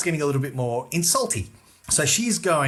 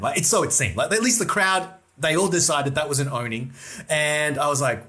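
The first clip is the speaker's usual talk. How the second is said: normal talking voice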